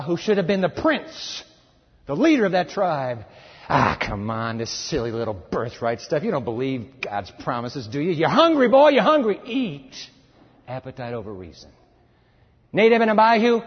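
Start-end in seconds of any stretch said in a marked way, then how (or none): 3.7-8.1 low, dismissive tempter voice; scoffing tempter. Retold or imitated. Satan mocking Esau's birthright, low and scoffing. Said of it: "Satan comes". 8.1-10.1 high, loud urging cry; tempter yell. Retold or imitated. Satan pressing hungry Esau, loud and urgent. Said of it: "Satan comes".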